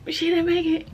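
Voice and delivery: very high, crying voice; real voice breaking upward into crying; tearful. Real, not acted. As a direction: repeat the bad news as tears take over. Tearfully repeats news of failing.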